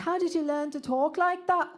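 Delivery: high, put-on British accent. British exchange student questioning a classmate, in a British accent. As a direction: ask it as a mock British student. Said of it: "a bunch of British exchange students".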